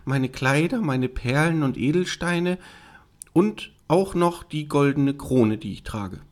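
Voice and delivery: high princess voice, listing item by item; offering princess. Acted. Princess offering the frog her treasures, eager to bargain. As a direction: play a princess tempting with treasures.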